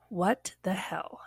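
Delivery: slow, whispery disbelief; hushed bewilderment. Retold or imitated. Employee locked in pantry with coworker, hushed bewilderment. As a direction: drop to a soft, drawn-out mutter.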